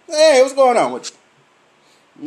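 loud, drawn-out, high hello; loud slow greeting. Retold or imitated. Coworker greeting him on the street, loud and slow. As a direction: stretch out a big loud greeting.

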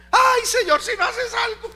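high, loud whining sigh to the Lord; mock whining prayer. Pastor mimicking a complaining believer, high and whiny. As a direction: parody a believer's lament.

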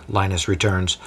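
usual speaking voice

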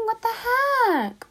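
high, slow drawn-out what the heck; puzzled exclamation. Real, not acted. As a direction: stretch it out in disbelief.

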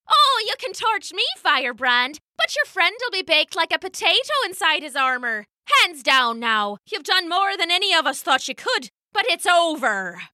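high, taunting pirate voice with a laugh under it; mock the cornered hero from up high; gloating pirate. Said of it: "the pirate only laughed".

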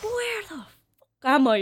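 high-pitched baffled wail, played up for the story; mock dismay in a high voice; retold bewilderment. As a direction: act out being suddenly lost and clueless. Lost newcomer in blank room.

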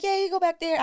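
put-on high child voice; little girl voice. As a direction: answer brightly like a kid. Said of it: "She was like".

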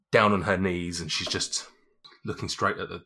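ordinary talk

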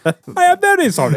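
high, quick, laughing mock apology. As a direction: apologise with exaggerated meekness through laughter.

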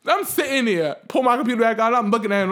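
ordinary talk, nothing marked

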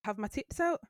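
ordinary talk, nothing marked

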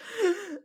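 sharp, high gasp for air; imitated gasp. Cohost recalling sobbing at the show, imitated high gasp. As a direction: act out gasping from sobbing. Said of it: "gasp for air".

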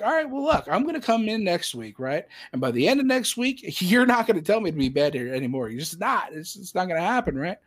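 high-pitched, emphatic retold protest; defiant vow. Employee vowing to his supervisor, defiant. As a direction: insist on it, pitch jumping up.

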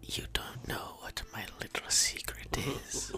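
teasing secret whisper. Acted. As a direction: whisper it tauntingly.

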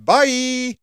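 sing-song fake farewell, high and loud; mock goodbye. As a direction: wave the listeners off in jest. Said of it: "we're obviously taking the piss".

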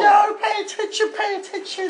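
high, scolding comic mum voice; Anne. Acted. Caller's mum correcting the confused host, high falsetto. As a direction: tell them off in a put-on mum falsetto. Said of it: "It's Dave's mum".

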